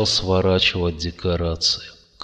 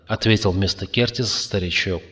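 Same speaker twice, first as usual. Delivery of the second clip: plain reading voice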